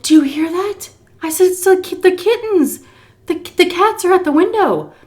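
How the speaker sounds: high, loud put-on excitement; fake excitement. Caregiver distracting Miss C, high excited voice. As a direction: gush to distract her.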